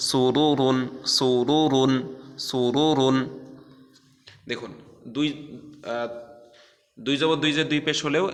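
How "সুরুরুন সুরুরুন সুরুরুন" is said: louder সুরুরুন with each R thick; chanted tajweed demo of সুরুরুন; heavy R recitation. Shown as a demonstration. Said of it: "মোটা করে পড়তে হবে রকে".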